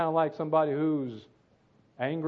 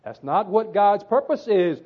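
ordinary talk, nothing marked

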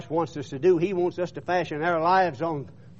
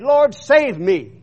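slow, loud, higher imitated plea; retold cry for help; 5 semitones higher, 7 dB louder, much slower than usual. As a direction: call out slowly as if sinking, preacher-style. Retold Peter sinking, calls for help.

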